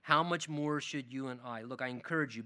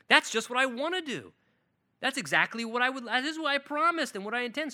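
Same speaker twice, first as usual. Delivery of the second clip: higher, eager voice of the Father; enthusiastic; 7 semitones higher, 4 dB louder than usual. Acted. God eagerly answering the prayer, higher and excited. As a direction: burst with eager delight. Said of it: "he gets enthusiastic".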